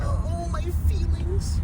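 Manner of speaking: mock whiny. Mock hurt feelings.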